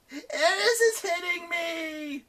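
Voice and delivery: high, slow tattling whine; whining. Acted. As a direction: tattle through tears. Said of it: "someone cried".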